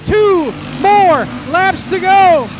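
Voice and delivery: lively live race call; motorsport commentator. Real, not acted. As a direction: call the laps as they unfold. Live race call after restart.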